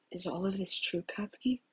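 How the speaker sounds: very soft hurt whisper; stunned, hushed question. Acted. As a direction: barely breathe the question. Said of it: "he whispered".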